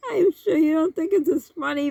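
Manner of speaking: giggling apology to the viewer; laughing still. Real, not acted. To the viewer, still laughing.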